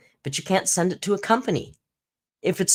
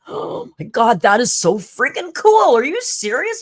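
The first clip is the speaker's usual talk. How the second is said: high, loud gushing Oh my God; excited bank teller; 7 semitones higher, 7 dB louder than usual. Retold or imitated. Teller gushing over her Amazon trip, excited. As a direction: gush like a thrilled stranger. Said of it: "He was, like, so excited".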